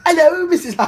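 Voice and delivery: high, loud mock British voice; hammed-up British caller impression. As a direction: put on a high British accent for a phone greeting. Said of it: "[name] called me with a British voice".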